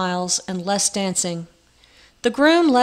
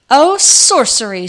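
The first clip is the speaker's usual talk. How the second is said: high, loud squeal of delighted horror; delighted squeak; 4 semitones higher, 9 dB louder than usual. A narrator's reading. Queen squeals at the knight's answer, delighted horror. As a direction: squeak it out, thrilled and shocked. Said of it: "squeaked the queen in delicious horror".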